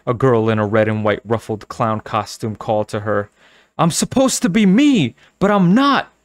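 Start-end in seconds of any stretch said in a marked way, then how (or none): 3.8-6.1 high, loud and bitter; bitter outburst. Acted. Girl bitterly answering the trick-or-treaters in her head, loud and bitter. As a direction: spit it out in bitter frustration. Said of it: "thought bitterly".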